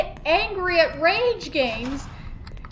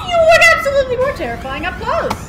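excited yell; 9 semitones higher, 8 dB louder, much faster than usual. Real, not acted. Monster close up.

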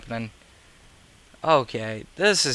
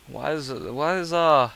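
normal talking voice

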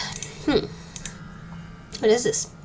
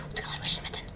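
hushed whisper of the same question; 11 dB quieter, much faster than usual. Real, not acted. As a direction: whisper it to yourself, puzzled. Repeats question, then whispers it.

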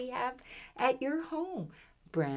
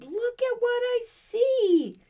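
much higher and louder, thrilled; excited roar; 5 dB louder than usual. A narrator's reading. Lion cub thrilled at finding friends, excited roar. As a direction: burst out with delight. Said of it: "roars the lion cub".

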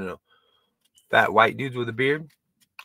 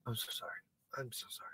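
trailing off into a whisper; fading whispered apology; 23 dB quieter, much faster than usual. Retold or imitated. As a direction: apologize softer and softer until it's just breath. Mock compulsive apologizing to women.

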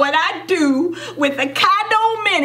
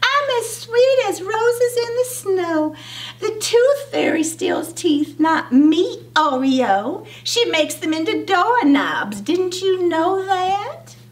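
high, sugary big-sister voice; high-voiced smug sister; sister's voice; 6 semitones higher than usual. A narrator's reading. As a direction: sound sweetly innocent and superior, pitched up. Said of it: "she said".

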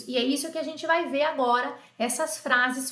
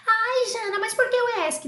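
high, whining "Ai, Jana"; whiny student; 10 semitones higher than usual. Retold or imitated. Imagined student protesting to the teacher, high and whiny. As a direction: complain like a frustrated beginner. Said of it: "imagined student whining".

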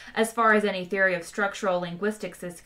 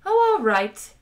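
reluctant consent; 11 semitones higher, 9 dB louder than usual. A narrator's reading. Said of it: "looked at them doubtfully".

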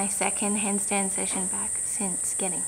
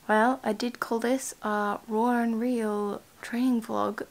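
ordinary talk

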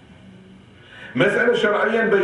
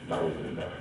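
hushed whisper, much lower and softer; whispered refuge; 10 semitones lower, 13 dB quieter, much faster than usual. Real, not acted. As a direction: breathe it as a pious aside. Pious aside after horror. (همس خاشع بالاستعاذة)

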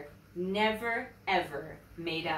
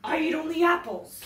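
higher, loud lion voice; protesting lion; 8 semitones higher, 7 dB louder than usual. A narrator's reading. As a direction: protest loudly as a wronged lion.

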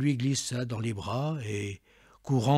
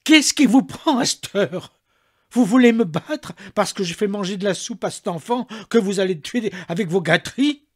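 high, loud furious servant; maid shouting back; 7 semitones higher, 9 dB louder than usual. A narrator's reading. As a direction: shout her defiance at him.

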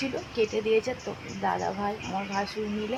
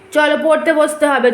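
loud, firm call to study; 5 semitones higher, 10 dB louder than usual. Real, not acted. Mother orders child to study.

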